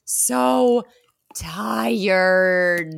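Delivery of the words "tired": long, drawn-out, whispery groan of a word; weary exasperation. Real, not acted. Podcaster fed up with a tired movie trope, weary exasperation. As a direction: drag it out, low and whispery, running out of air.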